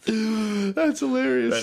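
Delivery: slow, laughing through the words. Real, not acted. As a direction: still giggling. Reacting to funny dog fight.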